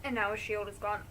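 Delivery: usual speaking voice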